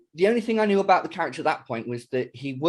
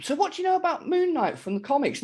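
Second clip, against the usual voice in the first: high-pitched imitation of his daughter; mock kid voice, asking dad a question; daughter's voice; 12 semitones higher than usual. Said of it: "my daughter turns to me and she goes".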